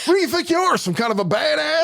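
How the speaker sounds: high-pitched, shouted mock outrage; scolding parent. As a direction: tell off a reckless kid, hammed-up. Acted scolding of imagined child.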